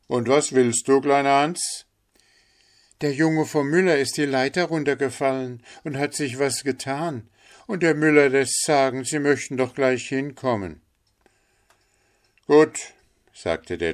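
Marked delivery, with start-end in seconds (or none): 0.1-1.9 doctor, louder and higher, asks down; raised questioning call; doctor asking. A narrator's reading. 3.0-10.8 Hans, higher and fast, delivering the message; quick, higher urgent report. A narrator's reading. 12.5-12.9 curt loud doctor; brief, loud, higher assent; doctor agreeing. A narrator's reading.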